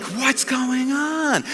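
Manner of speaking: high, soft, very drawn-out wonder; amazed. Retold or imitated. Apostles puzzled by the folded garments, amazed and bewildered. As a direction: stretch it out in mock bafflement. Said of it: "and they're amazed".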